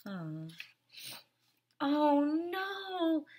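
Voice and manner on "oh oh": normal talking voice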